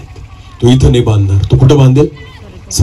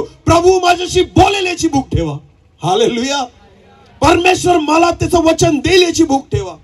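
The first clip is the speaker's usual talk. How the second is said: high, insistent exhortation without extra volume; impassioned preaching. Acted. Preacher urging congregation to hunger for God's word, impassioned high-pitched exhortation. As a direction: drive each appeal home at a high, sustained pitch.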